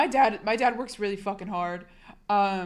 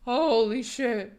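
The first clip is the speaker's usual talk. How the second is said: drawn-out stunned exclamation; slow amazement; 2 semitones higher, much slower than usual. Real, not acted. Streamer realizing how far off the year is, slow amazement. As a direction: let it sink in, stretching each word.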